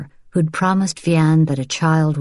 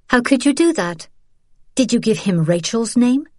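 higher, appalled accusation; Isabel, horrified; 7 semitones higher than usual. Acted. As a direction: demand it in disbelief.

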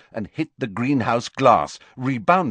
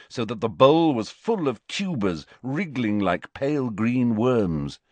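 ordinary delivery

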